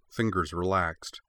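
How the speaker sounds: ordinary delivery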